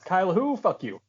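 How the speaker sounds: normal talking voice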